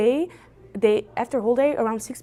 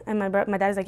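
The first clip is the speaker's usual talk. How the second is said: normal talking voice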